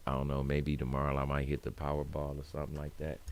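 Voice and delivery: ordinary talk, nothing marked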